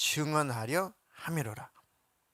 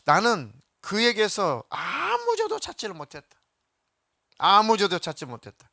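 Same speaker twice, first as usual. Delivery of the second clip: louder, higher public declaration; loud proclamation; 5 semitones higher, 6 dB louder than usual. Acted. Pilate announcing to the crowd, loud and raised.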